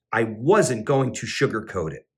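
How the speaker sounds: ordinary delivery, nothing marked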